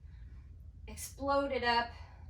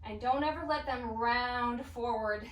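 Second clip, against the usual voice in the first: normal talking voice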